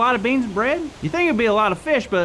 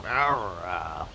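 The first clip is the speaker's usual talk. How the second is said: deep low rumbling growl, much lower and softer; pirate growl; 8 dB quieter than usual. Shown as a demonstration. [name] showing kids the pirate growl. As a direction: growl it like a pirate clearing his throat. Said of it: "lower your voice to, like a growl".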